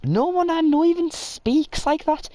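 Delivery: very high, loud, drawn-out protest; exasperated, slow and very high; high-pitched indignation. Real, not acted. Indignant denial of the mocked accent.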